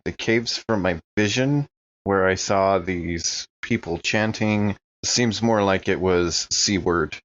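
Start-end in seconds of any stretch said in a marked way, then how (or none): none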